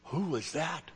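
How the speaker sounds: put-on small, shaky child asking in fear; a frightened little voice, soft; trembling voice. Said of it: "with a trembling voice".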